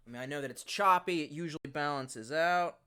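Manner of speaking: ordinary delivery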